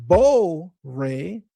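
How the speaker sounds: "Boré" split slowly into bo and ray; teacherly, drawn-out "Boré" with long O; pronunciation demo. Shown as a demonstration. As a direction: stretch the O of "Boré", then place "ray" separately. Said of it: "So when you pronounce".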